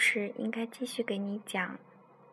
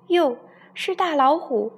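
startled; 6 semitones higher, 9 dB louder than usual. Acted. Doctor startled at window.

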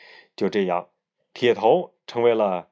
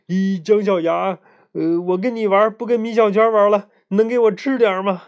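higher, eager begging for a bite; drooling eagerly; 8 semitones higher, 5 dB louder than usual. Acted. Greedy boy begging for an egg tart, eager. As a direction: plead greedily for a treat. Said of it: "铁头流着口水说".

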